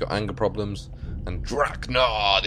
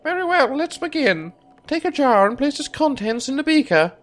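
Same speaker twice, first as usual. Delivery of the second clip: much higher, exclaiming wizard voice; eccentric alchemist voice; 4 dB louder than usual. Acted. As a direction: play a giddy old alchemist.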